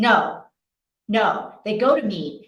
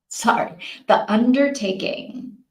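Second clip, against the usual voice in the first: normal talking voice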